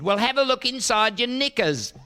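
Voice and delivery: put-on chirpy kid, high and cheeky; little kid. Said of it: "the little kid said".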